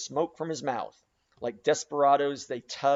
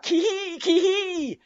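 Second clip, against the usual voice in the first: very high, loud yelping cry; wild yell; 6 dB louder, slower than usual. A narrator's reading. Daggoo straining at the oar, wild high yelping yell. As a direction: yelp it out like a caged tiger. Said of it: "yelled Daggoo".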